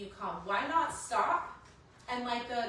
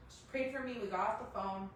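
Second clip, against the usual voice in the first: usual speaking voice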